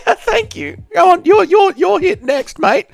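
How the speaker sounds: tiny squeaky voice. Pretend. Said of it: "Do an impression of Dark Lord Ben, Light Lord Ben, Tiny Ben".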